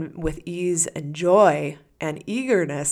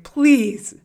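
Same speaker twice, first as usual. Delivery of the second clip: exasperated plea; 2 semitones higher, 7 dB louder than usual. Retold or imitated. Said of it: "You're like".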